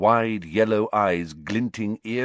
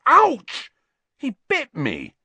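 sudden yelp of pain; 6 dB louder, slower than usual. Acted. Rat bites Ron.

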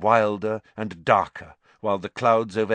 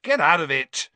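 loud, angry shove-off; angry shout; 8 semitones higher, 6 dB louder than usual. A narrator's reading. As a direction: drive the cat away. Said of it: "shoved Crookshanks angrily away".

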